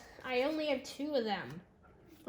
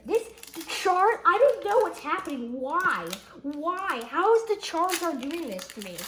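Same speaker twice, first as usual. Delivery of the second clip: raised, loud, bewildered exclaiming; excited disbelief; 4 semitones higher, 7 dB louder than usual. Real, not acted. Kid baffled by another Charizard pull, loud excited disbelief. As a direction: exclaim it, overwhelmed by the luck. Stunned by yet another lucky card.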